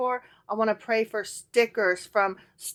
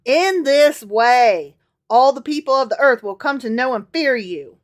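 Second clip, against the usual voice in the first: put-on Southern accent; 2 semitones higher, 7 dB louder than usual. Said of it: "Solomon continues in his southern accent".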